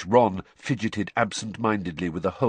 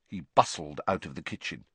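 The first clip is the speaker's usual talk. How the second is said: plain reading voice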